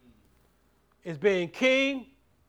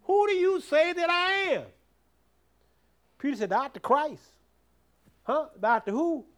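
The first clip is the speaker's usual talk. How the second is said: raised, louder preacher's drive; preaching at full force; 4 semitones higher, 6 dB louder than usual. Acted. Preacher drives the point home to the church, loud. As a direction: push it out to the congregation.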